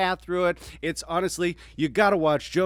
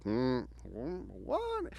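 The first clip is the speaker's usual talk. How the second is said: mock judge reaction; 9 dB quieter, much slower than usual. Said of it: "just making faces like".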